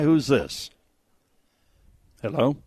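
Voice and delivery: ordinary delivery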